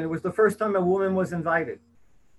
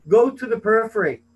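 higher and louder, hitting "Go"; emphatic quote; 5 semitones higher, 6 dB louder than usual. Retold or imitated. Quoting Pope Francis to the audience, emphatic and loud. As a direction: push "Go" out as a forceful command. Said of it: "As Pope Francis has said rather emphatically".

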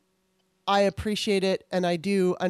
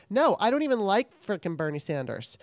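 usual speaking voice

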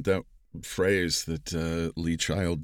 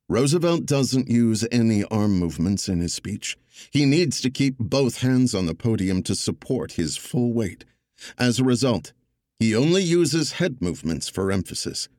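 louder, measured storytelling read; audiobook narration; 5 semitones higher, 6 dB louder than usual. Acted. Narrator reading his audiobook excerpt to the panel, louder measured narration. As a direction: narrate it for the listener, steady and deliberate.

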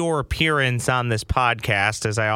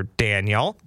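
normal talking voice

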